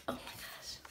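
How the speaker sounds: breathless whispered oh my gosh; hushed, starstruck whisper; awed whisper. Real, not acted. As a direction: whisper it in disbelief.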